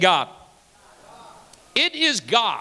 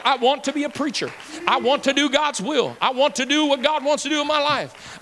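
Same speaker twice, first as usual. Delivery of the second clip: higher, building eager cry; joyful convert voicing his new wants; 7 semitones higher than usual. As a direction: let the longing rise and swell. Converted self, rising eagerness.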